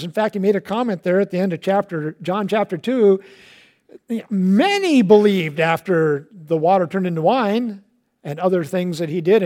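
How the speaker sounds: ordinary talk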